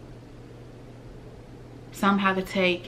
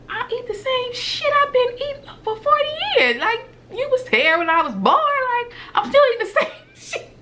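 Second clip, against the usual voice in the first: much higher and louder, incredulous, laughing at the end; exasperated retort; 6 dB louder than usual. Retold or imitated. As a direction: squeal it out in amused disbelief. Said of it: "It's like".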